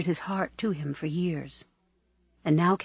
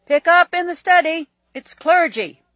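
loud, high call across the house; bellowing; 13 semitones higher, 12 dB louder than usual. A narrator's reading. Walter calling Catherine to the study phone, bellowing. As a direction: holler it to someone in another room. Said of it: "bellowed Walter".

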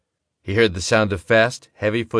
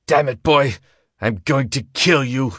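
angry shout; 5 semitones higher than usual. A narrator's reading. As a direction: yell the threat over gunfire. Gambler shouting threat in gunfight.

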